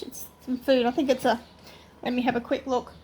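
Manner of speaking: ordinary delivery, nothing marked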